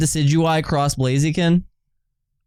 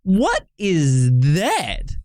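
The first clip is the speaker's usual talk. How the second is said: slow disbelief; 2 semitones higher, much slower than usual. Real, not acted.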